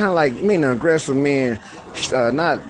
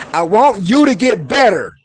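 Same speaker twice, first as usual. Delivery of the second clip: louder and higher, pushing the line; fired-up plea to get better; with emotion; 6 semitones higher, 4 dB louder than usual. Shown as a demonstration. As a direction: plead it with rising heat. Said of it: "so you add emotion sometime with the word".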